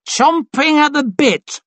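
very high, slow bray; drunken bray. A narrator's reading. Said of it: "brayed one of Glocta's staff".

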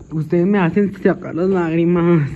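loud wail, pretending to cry; mock tearful wail. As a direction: wail loudly as if tears are coming. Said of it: "Yo quería llorar". (lamento fuerte, fingiendo llanto)

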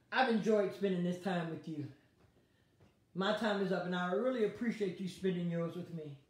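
low, soft closing words; gentle farewell. Real, not acted. Preacher signing off, gently thanking her viewers. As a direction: send them off gently.

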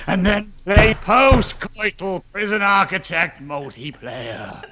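booming movie-trailer announcer, low and slow; deep trailer voice. Pretend. Game developer hams up a trailer read for his colleague, deep announcer voice. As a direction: sell it like a cinema trailer narrator. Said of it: "I've got to get my deep voice going".

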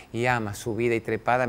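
ordinary talk

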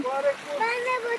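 high gasping cry from the cold; in ice cold water. Real, not acted. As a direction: squeal at the freezing water. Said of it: "buz gibi suda".

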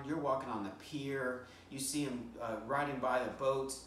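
ordinary delivery

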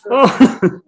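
sudden loud high oh of realization; startled loud oh; surprised oh. Real, not acted. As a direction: caught off guard, then laughing.

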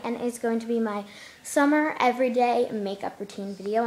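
ordinary talk

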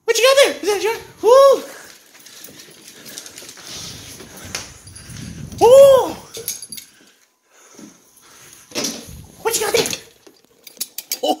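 high, squeaky pet-talk voice. Real, not acted. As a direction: coo at the dog way up high. Cooing at the dog.

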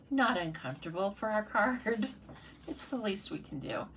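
normal talking voice

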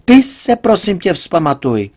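high loud start that calms; exasperated yell. A narrator's reading. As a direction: snap at her to come to her senses. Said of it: "proč křičíš".

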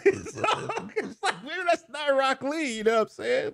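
high-pitched laughing through the words. Real, not acted. Doubting the Rock Lee guess, high laughing delivery.

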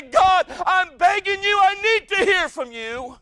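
high, slow, loud pleading; begging prayer. Acted. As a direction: plead with God at the top of your voice. Acted desperate prayer.

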